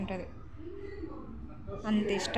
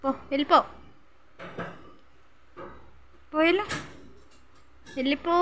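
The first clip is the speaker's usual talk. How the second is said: shooing off; 5 semitones higher than usual. Real, not acted. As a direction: push the dog away with sharp calls. Shooing the dog away.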